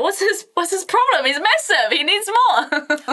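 high, incredulous hosts; mock baffled hosts. Hosts puzzled by the big guest, high incredulous. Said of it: "And they're like".

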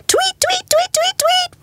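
very high, repeated chirps; tweeting birds. A narrator's reading. Birds waking tired Mister Bear at dawn, high tweeting. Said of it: "went the birds".

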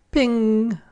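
high bright ping like a shop bell; door chime ping. A narrator's reading. Door bell sound in story.

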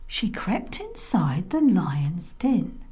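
ordinary delivery, nothing marked

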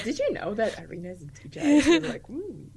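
lightly voiced proud grandparent, laughing; a hint of a gossiping elder; imitating grandparents. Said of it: "To be like".

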